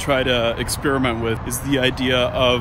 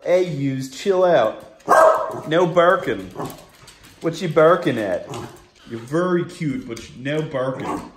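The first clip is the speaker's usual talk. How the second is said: talking to the dog; 4 semitones higher than usual. Real, not acted. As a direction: gently tell off a cute barking dog. Scolding his barking dog.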